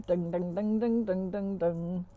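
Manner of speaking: sung-out banjo plucks; banjo imitation. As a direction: mimic a twanging banjo riff. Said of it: "she gets her banjo out".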